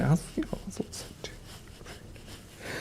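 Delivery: breathy hushed confession; whispered aside. Real, not acted. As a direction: whisper it as if the dog shouldn't hear. Private aside about calling names.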